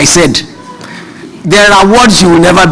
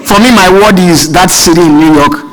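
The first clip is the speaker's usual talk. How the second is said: ordinary delivery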